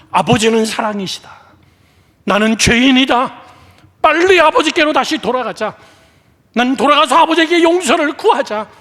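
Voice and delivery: rising from firm to high, loud urgency; building repentant resolve. Acted. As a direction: cry it out as a decision, building.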